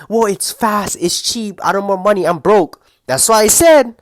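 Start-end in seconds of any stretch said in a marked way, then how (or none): 0.1-2.8 higher, lightly mocking whiny excuse voice. Mocking viewers' fast food excuses, lightly whiny. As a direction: parody the lazy excuse-maker.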